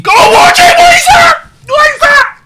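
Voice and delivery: very high, loud squeal; panicked, squeamish falsetto shriek; horrified screaming. Real, not acted. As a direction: shriek as if you can't bear to look. Screaming at gruesome video.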